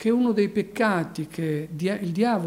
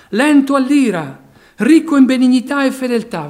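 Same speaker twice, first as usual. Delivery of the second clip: preaching at full force; 6 semitones higher, 11 dB louder than usual. Acted. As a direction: drive each phrase home like a revival preacher. Proclaiming God's attributes.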